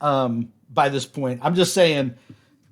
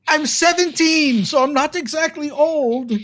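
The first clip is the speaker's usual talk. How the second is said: put-on young man in the well; 11 semitones higher than usual. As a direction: correct them, slightly offended, up high.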